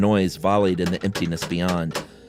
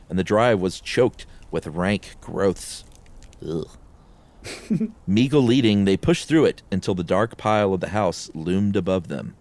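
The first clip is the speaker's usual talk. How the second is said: plain reading voice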